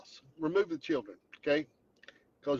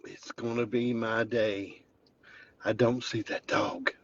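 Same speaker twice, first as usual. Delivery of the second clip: normal talking voice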